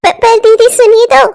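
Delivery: usual speaking voice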